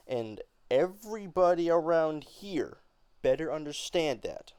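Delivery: loud, insistent; angry and determined. Acted. Beneatha insisting to her family, loud and angry. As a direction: declare it to the whole house. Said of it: "angrier tone".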